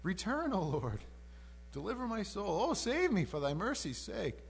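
acted plea with high-pitched appeals; pleading. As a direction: beg the Lord to come back. Acting out David's plea for return.

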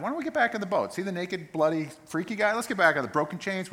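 a frightened rush to get back in the boat; panicky disciples. Retold or imitated. Disciples panicking, want to flee.

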